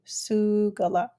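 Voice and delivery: Sugala said slowly, each syllable su-ga-la drawn out clearly; teacher's slow model of Sugala; slow repeat. Shown as a demonstration. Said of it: "our long vowel sound is a borrowed word".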